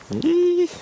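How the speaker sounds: very high loud squeal; high squeak. Real, not acted. Shot lands, squeaks.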